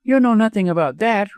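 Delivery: high, controlled retort; steady retort. A narrator's reading. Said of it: "but in a steady voice".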